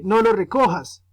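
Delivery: teacher demonstrating a firm command; giving an order. Shown as a demonstration. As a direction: forbid it firmly, like a parent's order. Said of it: "las imperativas ... dan órdenes".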